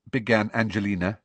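ordinary delivery

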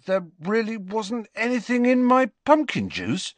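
high, amazed question; astounded. A narrator's reading. Boy learning he had no potion, astounded. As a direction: ask it in rising disbelief.

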